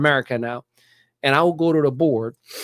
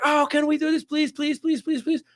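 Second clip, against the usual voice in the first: begging student; 13 semitones higher than usual. Retold or imitated. Eager student begging teacher.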